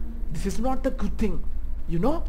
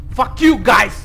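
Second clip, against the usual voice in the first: loud curse at the dolls; angry shout; 11 dB louder, slower than usual. Real, not acted. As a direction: yell it, startled and annoyed. Yelling at dolls.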